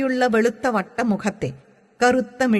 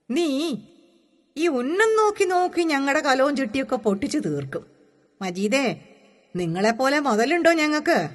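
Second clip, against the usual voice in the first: high-pitched village mother's grumble; complaining mother; 6 semitones higher than usual. A narrator's reading. Suhra's mother scolds Majeed for breaking her pots, complaining. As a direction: scold the boy in a higher, plaintive voice.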